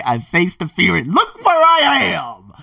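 high, loud, laughing triumph; slow it down and crow the victory; triumphant. Pretend. Imagined triumphant announcement from hotel.

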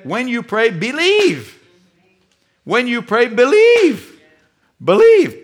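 loud, high cry on BELIEVE; emphatic preaching. Real, not acted. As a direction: punch out BELIEVE like a rallying command. Preacher drives home Jesus' command.

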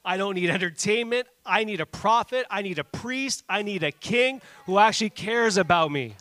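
loud, rising preacher's cry; passionate preaching. Real, not acted. Pastor crying out to his congregation, loud and passionate.

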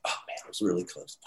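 a quiet letdown after missing the chance; disappointed mutter. Retold or imitated. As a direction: sigh it to yourself, almost under the breath. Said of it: "I was like".

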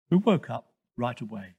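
ordinary delivery

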